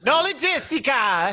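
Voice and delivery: fake Italian accent. Mocking no logistics talk in fake Italian. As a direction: ham up Italian on a made-up word. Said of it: "fake Italian accent".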